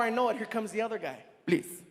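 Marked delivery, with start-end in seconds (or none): 1.4-1.8 pleading host. Retold or imitated. Voicing the other guy.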